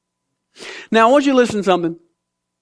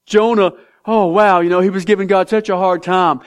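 usual speaking voice

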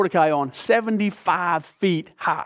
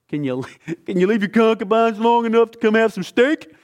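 laughing invitation; 6 semitones higher than usual. Acted. Esther inviting the king to dinner, laughing lightly. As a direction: tease the king through a laugh.

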